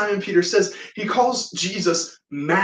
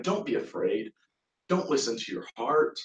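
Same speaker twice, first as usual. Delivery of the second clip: low, very soft calming voice; gentle reassurance; 6 semitones lower, 10 dB quieter than usual. Retold or imitated. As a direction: soothe softly, voicing a retold comfort. Jesus calms a frightened Simon.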